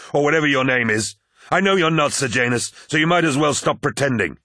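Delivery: high, forceful accusation; angry warning. A narrator's reading. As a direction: warn him loudly, calling out his lie. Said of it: "warned Horus".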